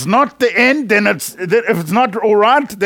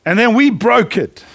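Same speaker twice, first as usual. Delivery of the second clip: chuckling as you say it; amused, laughing recap; 3 semitones higher than usual. Real, not acted. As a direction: laugh through the line.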